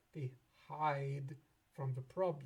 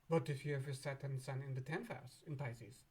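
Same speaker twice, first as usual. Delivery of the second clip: ordinary delivery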